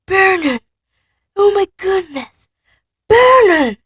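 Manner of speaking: very high, loud shriek; Petunia panicking. Acted. Horrified wife shrieking for her husband. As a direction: shriek for your husband in horror.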